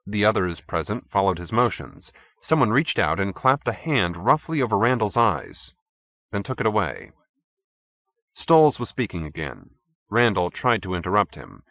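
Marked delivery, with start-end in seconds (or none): none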